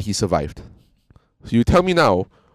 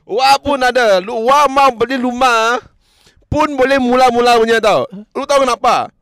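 much higher, louder cocky voice; mock boastful bully; 13 semitones higher, 6 dB louder than usual. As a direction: brag like a street tough flaunting rights. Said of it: "It's like".

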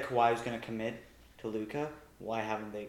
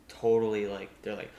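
ordinary delivery

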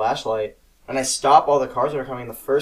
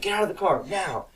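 retold yell; 6 semitones higher than usual. Dad ordering his kid out of the car, mock yell. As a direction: imitate an angry dad's order. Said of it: "And he yells at me".

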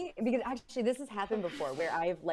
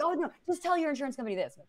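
quick, higher know-it-all advice; mock know-it-all; 9 semitones higher, faster than usual. Mocking her partner's quick unwanted advice, smug and fast. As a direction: rattle off the fix smugly. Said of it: "you'll be like".

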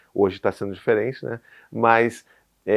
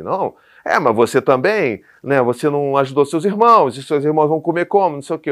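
loud, higher-pitched scolding; authoritarian parent imitation; 4 semitones higher, 8 dB louder than usual. Parent defending a perfect image to a child, mock authoritarian. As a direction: turn the blame back on the child, forcefully. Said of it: "de autoritário".

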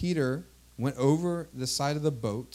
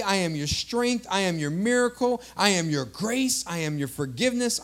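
rhythmic, insistent "I am" declarations; preaching litany; 5 semitones higher, 4 dB louder than usual. Real, not acted. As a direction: build a rolling list with the same cadence each time.

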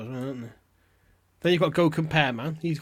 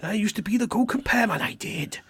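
put-on a lightly done Welsh accent, higher and lilting; mock Welsh lilt, a little raised; bad Welsh accent; 7 semitones higher, 5 dB louder than usual. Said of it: "the best I can do with a Welsh accent".

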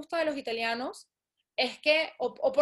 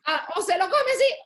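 laughing retort; 5 semitones higher, 6 dB louder than usual. Real, not acted.

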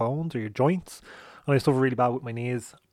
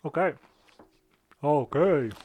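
Rocky Balboa voice; 3 semitones higher, slower than usual. Acted. Sound like Rocky Balboa there.

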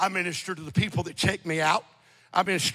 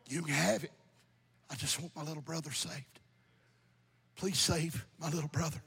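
retold tearful plea; 2 semitones lower, 9 dB quieter than usual. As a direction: quietly imitate a brother pleading through tears. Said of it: "He's crying".

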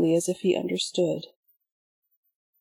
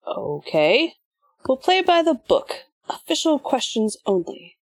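mocking villain; 6 semitones higher than usual. A narrator's reading.

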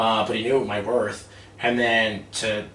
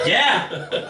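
high, laughing yes; gleeful yes; 10 semitones higher, 4 dB louder than usual. Retold or imitated. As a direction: gloat with a laugh. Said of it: "I was so excited".

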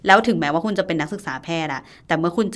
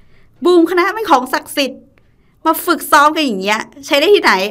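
raised voice, much higher and louder, mock scolding; senior's hazing rebuke; 9 semitones higher, 7 dB louder, slower than usual. Senior hazing juniors practising the faculty cheer, loud mock scolding. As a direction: imitate a senior berating the juniors. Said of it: "รุ่นพี่มาวากบอกว่า".

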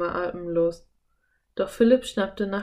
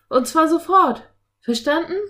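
high, loud order; angry bellow; 10 semitones higher, 8 dB louder than usual. A narrator's reading. Philipp ordering the octopus away, angry yelling. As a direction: bark orders at the beast. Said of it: "brüllte Philipp ihn an".

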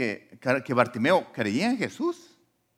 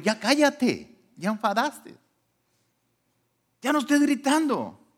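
higher, fed-up voice telling him to shut up; annoyed roosters; 10 semitones higher than usual. Retold or imitated. Roosters fed up with Bartimeo's shouting, higher scolding voice. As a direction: play the irritated roosters scolding. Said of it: "le dijeron".

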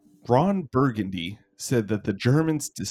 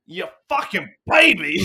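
loud, higher mock Boston yell; Boston accent mock; 8 semitones higher, 6 dB louder than usual. Host imitating a Boston person shouting, mock Boston yell. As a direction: bark it like a rowdy Boston guy. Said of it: "they'll be like".

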